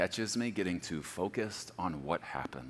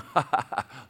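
amused chuckle; 5 semitones higher, 5 dB louder than usual. Retold or imitated. God laughing at the climber.

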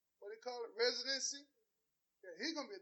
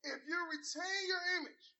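ordinary talk

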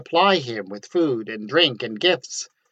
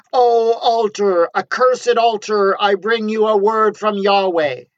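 prophet screaming; 8 semitones higher, 7 dB louder than usual. Acted. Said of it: "the new arrival screamed".

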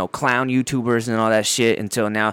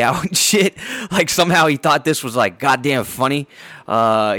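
ordinary delivery

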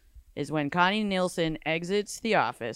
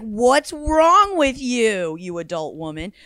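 exasperated; 3 semitones higher, 7 dB louder than usual. Acted. Mocking the character's baby talk.

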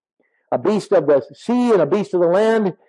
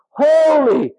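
HOLY ringing out high; emphatic holy; 9 semitones higher, 4 dB louder than usual. Real, not acted. Preacher calling the congregation to depend on God, emphatic. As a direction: lift HOLY high and hold it. Preacher repeats key word.

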